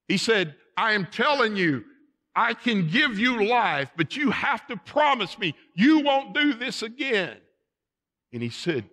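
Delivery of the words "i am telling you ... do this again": high, loud kingly ultimatum; acted stern command. King offering the deserter life on condition, loud acted sternness. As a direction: press hard for a promise.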